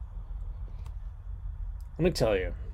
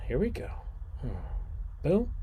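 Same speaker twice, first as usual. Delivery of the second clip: usual speaking voice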